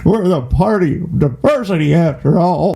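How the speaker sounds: lightly put-on Hillary parody; mock candidate voice. Host parodies the candidate's line in a mock voice. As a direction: say it as a smug politician. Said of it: "You just say".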